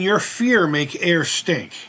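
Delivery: put-on slow, lightly done Japanese accent as Sato; mimicked Sato accent. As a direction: drag it out like the villain Sato, mildly. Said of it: "mimicking Sato in, in an accent".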